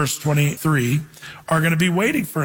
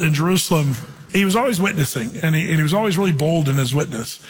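ordinary talk, nothing marked

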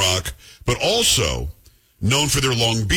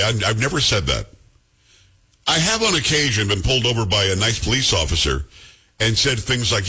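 normal talking voice

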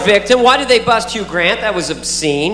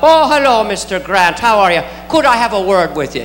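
a light Irish lilt, friendly; lightly voiced Irish copper; kindly Irish cop. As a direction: greet the star like a cheerful Irish cop. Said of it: "Some kindly old Irish cop".

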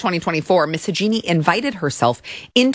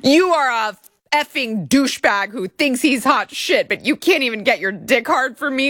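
higher, scornful mock scold; 5 semitones higher than usual. Reading the complaint, a mocking Hajini scolds the plaintiff. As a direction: play a scornful nag. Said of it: "She's scolding him".

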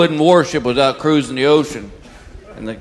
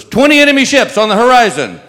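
high, loud lookout shout; calling out; 11 semitones higher, 10 dB louder than usual. Acted. Lookout in the crow's nest shouting to the captain. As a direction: yell it down from the mast.

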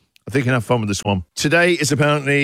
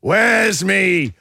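pirate growl; 2 semitones lower, much slower than usual. Pretend.

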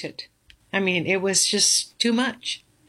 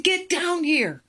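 much higher, urgent retold command; panicked order; 10 semitones higher than usual. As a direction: snap it out in high alarm. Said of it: "He said".

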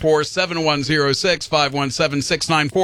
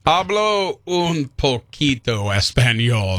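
slow, halting-paced American-accented Spanish; bad Spanish accent; 2 semitones lower, slower than usual. Real, not acted. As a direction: stumble slowly through Spanish in an English accent. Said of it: "your accent is horrible".

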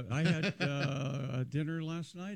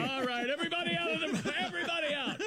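high, urgent evacuation call; hammed-up command, pitched way up; mock emergency order; 12 semitones higher, faster than usual. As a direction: play a technician clearing the room. Acts out technicians ordering evacuation.

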